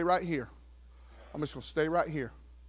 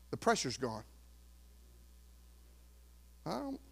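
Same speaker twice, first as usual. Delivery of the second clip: ordinary talk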